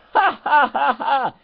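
shrill mock laugh in the teacher voice; squeaky ha-ha-ha; high cackle. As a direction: cackle like a cartoon villain. Cackles after his teacher rant.

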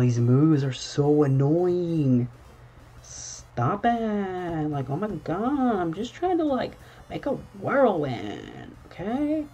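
high, slow, fed-up whine; exasperated whine. Real, not acted. Player fed up with an annoying move, high whine. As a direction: whine at the game. Frustrated at repeated moves.

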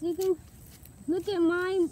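normal talking voice

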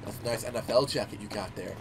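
low soft whisper in the ear; creepy quiet whisper. Retold or imitated. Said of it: "went over to his ear and whispered".

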